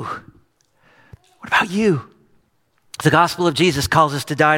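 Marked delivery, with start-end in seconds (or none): 0.0-2.1 hushed, drawn-out whisper; very slow breathy whisper of "What about you?". Acted. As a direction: lean in and breathe it to each listener. Repeated question, whispered slowly.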